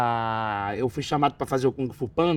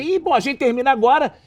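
bright, chirpy TV reporter wrapping up, higher; upbeat reporter; 6 semitones higher, 4 dB louder than usual. Retold or imitated. Narrator retelling a chirpy, higher-pitched reporter. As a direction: play the cheery morning-show host. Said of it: "o cara fala assim".

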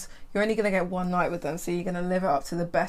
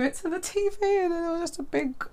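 squeaky high voice; 10 semitones higher than usual. Retold or imitated. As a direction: voice jumps way up in disbelief. Telling shocking story, pitch jumps.

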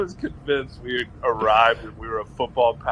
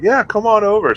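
much higher and louder, a friendly call; calling over; 12 semitones higher, 7 dB louder than usual. Retold or imitated. Uncle calling nephew past the rope, loud friendly call. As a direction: wave someone over across the field. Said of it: "He's like".

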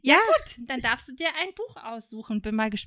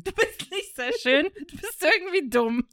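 high, loud, laughing re-quote; 8 semitones higher, 4 dB louder than usual. Retold or imitated. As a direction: giggle through the line. Amused re-quote of lyrics.

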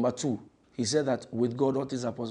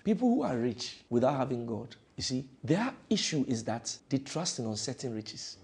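ordinary talk, nothing marked

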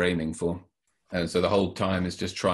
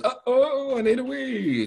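much higher little voice, anxious; urgent bush baby; 3 dB louder, slower than usual. Acted. Bush baby in his book needing a wee, high urgent voice. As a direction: squeak it out like a small creature desperate for a toilet. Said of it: "in the jungle and says".

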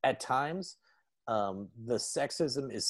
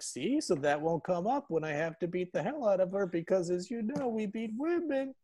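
mock Lance; 9 semitones higher than usual. Lance boasting to Speed in a high mocking voice. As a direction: puff it up, oblivious. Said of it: "he's like".

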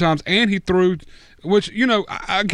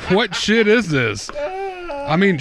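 laughing in disbelief; 6 semitones higher, much slower than usual. Real, not acted. Ranting about QB's fumbles, laughing.

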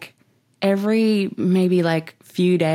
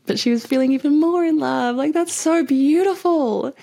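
delighted; 10 semitones higher than usual. Real, not acted. Gushing about couple.

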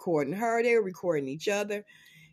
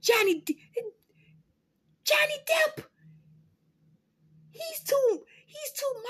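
mock pleading whine. Pleading for Johnny Depp.

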